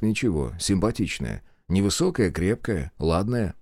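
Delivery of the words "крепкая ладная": usual speaking voice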